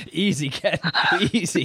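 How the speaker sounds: half-laughing mild caution; laughing warning. Real, not acted. Host reining in Ken over his joke, laughing warning. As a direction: chuckle while telling him to ease off.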